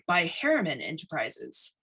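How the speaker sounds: ordinary delivery, nothing marked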